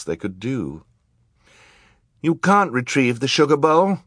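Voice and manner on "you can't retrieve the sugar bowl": put-on high-pitched, protesting child; raised, defiant child voice; Violet. A narrator's reading. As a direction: challenge the villain in a high voice. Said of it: "Violet said".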